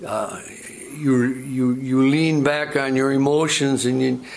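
ordinary talk

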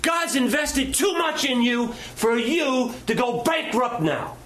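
high, slow, forceful declaration; urgent shout. Retold or imitated. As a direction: shout it to shake him awake. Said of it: "She said".

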